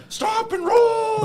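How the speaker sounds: high, slow mock yell; cartoonish cop shouting; shouted cop order. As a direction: bellow it like a panicked cop. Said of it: "he's like".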